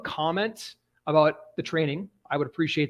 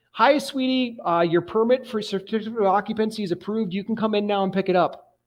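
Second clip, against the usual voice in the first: higher, sugary clerk voice; sweet lady on the phone; 5 semitones higher than usual. Retold or imitated. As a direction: cheerfully tell him his permit is approved. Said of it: "I hear this voice say".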